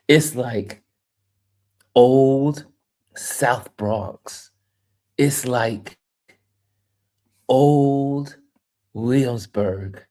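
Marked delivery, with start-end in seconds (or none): 1.9-2.7 put-on old held out and louder; old stretched and punched louder; stress on old. Shown as a demonstration. Punching the repeated word. 7.5-8.4 put-on old held out and louder; old stretched and punched louder; stress on old. Shown as a demonstration. Punching the repeated word.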